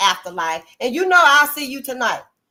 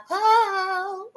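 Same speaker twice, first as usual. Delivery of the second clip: mock swooning holler; 9 semitones higher than usual. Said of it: "gonna holler out".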